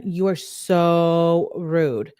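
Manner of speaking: "so" stretched very long in "You are so rude"; slow, exaggerated "sooo" before "rude"; drawn-out SO. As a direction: hold the vowel of "so" as if spelled with many O's. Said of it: "with, like, twenty O's".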